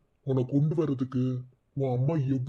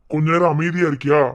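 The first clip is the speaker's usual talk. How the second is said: stern rebuke; 6 dB louder than usual. Acted. Telling aunt to be quiet.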